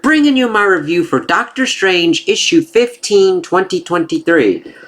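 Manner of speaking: high, drawn-out host greeting; show-opener announcement. Acted. Host greets viewers to open a comic review, high announcer intro. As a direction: announce it high, stretching the opening words.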